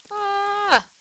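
short high-pitched "ah" scream; mild gamer's shriek; startled scream. Pretend. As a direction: cry out high as the monster appears. Fleeing a creeper in game.

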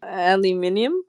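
"Aluminium" as al-yoo-MIN-ee-um; Australian "Aluminium", stress on min. Shown as a demonstration. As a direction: say "Aluminium" with five syllables, Aussie style. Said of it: "is that how you say aluminum".

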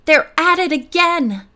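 sudden discovery, thrilled; urgent excited announcement; excited exclamation. A narrator's reading. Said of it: "she exclaimed".

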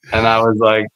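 ordinary talk

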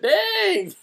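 very high drawn-out exclamation; amazed reaction. Retold or imitated. Host recalls reacting to the student's callout, high amazed cry. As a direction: let it leap way up, impressed.

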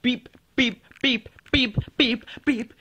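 high robotic beep beep; rabbit beeping. Retold or imitated. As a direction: chirp like a little beeping bunny. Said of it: "that's why I was like".

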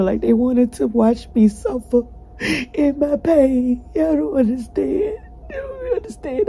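pleading through tears, pitch climbing; begging to be understood, sobbing; real crying. Real, not acted. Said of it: "I'm cried out".

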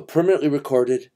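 ordinary talk, nothing marked

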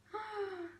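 sharp, quick intake of breath; startled gasp. Acted. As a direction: gasp in fright. Thief startled in the dark.

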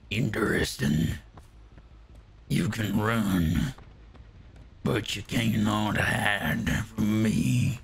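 drawn-out sinister taunt; slow menacing villain. Acted. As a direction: savor each word like a horror villain.

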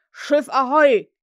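loud, slow hail across the lagoon; Hook's call. Acted. Hook calls his boat from the water, loud slow hail. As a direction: call out long over the water. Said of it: "mit Hooks Stimme über die Lagune scholl".

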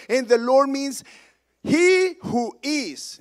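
loud, high scripture proclamation; full-force preaching. Acted. Preacher declaring God's name to the congregation, full-force preaching.

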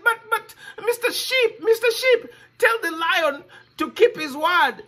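high, desperate begging; crying plea. Acted. Boy begs the lion not to eat him, high and desperate. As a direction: plead high like a scared child.

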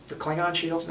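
usual speaking voice

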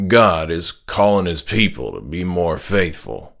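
father announcing his new calling, firm and low; stern religious father. Acted. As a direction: speak as the dad, sure of his faith. Father's voice in story.

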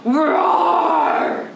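loud tiger roar, first try; practice tiger roar. Shown as a demonstration. Practising tiger hello with children, loud roar. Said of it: "practice getting ready to say hello to tiger".

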